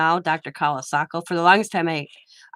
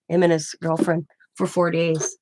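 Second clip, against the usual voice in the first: ordinary delivery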